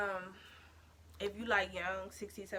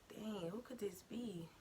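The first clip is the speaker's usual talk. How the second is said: breathy hushed question to herself; scared whisper; 10 dB quieter than usual. Retold or imitated. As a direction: whisper it, afraid to be heard. Hearing someone at the door.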